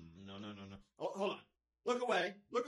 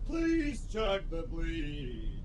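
slow pleading; 6 semitones lower, much slower than usual. Acted. Begging slowly.

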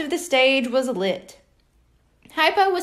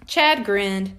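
ordinary delivery, nothing marked

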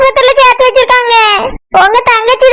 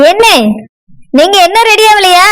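lower-voiced, doubting wife; a wife who is unimpressed, lower voice; dismissive wife; 6 semitones lower than usual. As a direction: brush the news off, lower.

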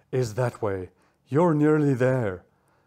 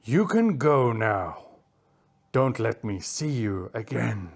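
stern big cat dismissal; threatening dismissal; 9 semitones lower than usual. Acted. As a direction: send them off with menace. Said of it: "snarling as his large teeth threatened".

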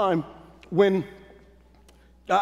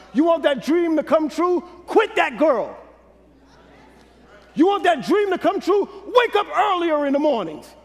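preaching at full force; 6 semitones higher than usual. Acted. Preacher exhorting congregation to chase their dream, full force. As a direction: hammer each question up.

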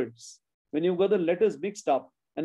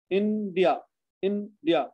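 INDIA in two beats: In, dia; split into syllables; much slower than usual. Shown as a demonstration. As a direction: gap after In each time in India.

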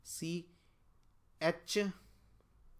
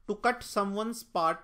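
slow reading aloud; 5 semitones higher, 4 dB louder, much slower than usual. Shown as a demonstration. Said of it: "reading an option".

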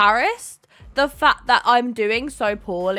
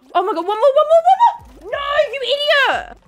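frantic panic; 11 semitones higher, 8 dB louder than usual. Real, not acted. Frantic at last button.

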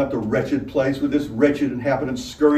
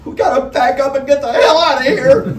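much higher, loud panicked cry; strained cry; 13 semitones higher, 8 dB louder than usual. A narrator's reading. Straw Hat crying out to the others in panic. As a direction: force it out through a tight throat. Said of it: "cried out through a strained throat".

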